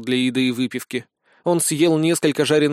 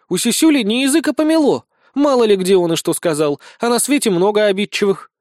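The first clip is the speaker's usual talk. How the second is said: high, loud, dismissive chatter; scoffing; 7 semitones higher, 5 dB louder than usual. A narrator's reading. Small blonde blames the sergeant's mouth, scoffing. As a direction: jeer at his careless tongue. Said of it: "усмехнулась Безос".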